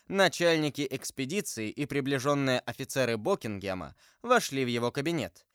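usual speaking voice

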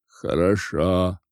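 slow, low, pondering; musing. A narrator's reading. Old woman softening toward the students, slow musing consent. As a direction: draw it out as if thinking it over. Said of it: "как бы размышляя".

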